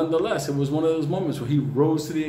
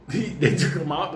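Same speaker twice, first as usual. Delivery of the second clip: usual speaking voice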